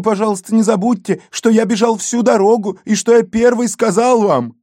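put-on high, whining begging boy; nasal whine. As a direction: plead in a squeaky kid's whine. Boy pleads again for reward.

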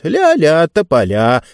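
mocking sing-song brush-off; sarcastic. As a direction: tease them, dripping with sarcasm. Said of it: "язвительно добавила Диба".

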